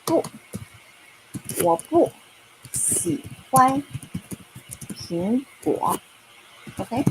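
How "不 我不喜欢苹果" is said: 我不 pitched high, then 喜欢, 苹果 spaced out; teacher modelling the negative sentence slowly; word by word. Shown as a demonstration. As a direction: give each word its own beat. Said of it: "You can say".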